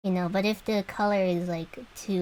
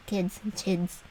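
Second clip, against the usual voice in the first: usual speaking voice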